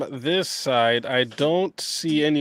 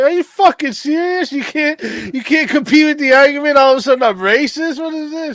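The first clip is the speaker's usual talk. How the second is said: much higher, louder indignant outburst; outraged disbelief; 13 semitones higher, 7 dB louder than usual. Retold or imitated. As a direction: fire back at the absurd accusation. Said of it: "I'm like".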